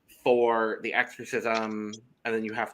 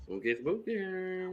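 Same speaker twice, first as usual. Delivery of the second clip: high, soft, drawn-out ghostly wail; hammed-up spooky singsong; playful spooky voice; 7 semitones higher, 8 dB quieter, slower than usual. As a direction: stretch it out like a mock ghost. Playful spooky echo of comp's theme.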